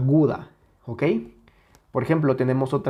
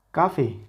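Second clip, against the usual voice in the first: "café" stressed on "ca", dropping on "fe"; sin fuerza en la última; 2 semitones higher than usual. Shown as a demonstration. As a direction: say "café" as CAfe. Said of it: "Ya no es lo mismo". ("café" con el golpe en "ca", "fe" débil)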